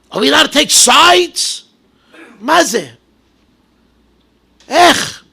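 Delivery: fiery preaching. Acted. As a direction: thunder each line at the crowd like a preacher. Preaches at full force.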